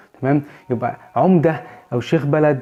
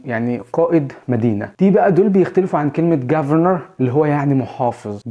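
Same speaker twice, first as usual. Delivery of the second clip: ordinary delivery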